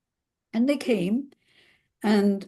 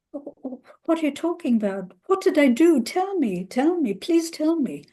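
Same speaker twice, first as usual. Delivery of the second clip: frightened pleading; 6 semitones higher than usual. Retold or imitated. Wife begging husband to explain.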